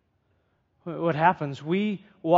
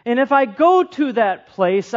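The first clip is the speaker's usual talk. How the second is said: drawn out, "go" pushed up; the preacher stresses the repeated promise for listeners, slow and deliberate; slow emphatic quote; 4 semitones higher, slower than usual. Shown as a demonstration. Slow, lean on "place" and "go". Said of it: "He says it two times".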